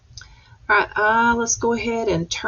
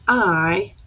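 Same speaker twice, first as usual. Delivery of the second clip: slow read-aloud; much slower than usual. Shown as a demonstration. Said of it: "So it says".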